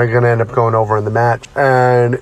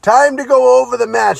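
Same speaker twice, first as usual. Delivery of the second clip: Jimmy Snuka impression; 10 semitones higher than usual. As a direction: ham up the fired-up veteran, pitched high. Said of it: "he looks at me and goes".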